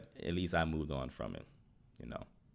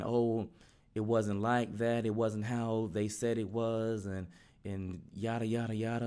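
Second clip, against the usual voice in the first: higher-pitched mock protest, dragged out; imitated rambling excuses; 5 semitones higher than usual. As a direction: lightly mimic someone rambling on defensively. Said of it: "he started rambling on and on".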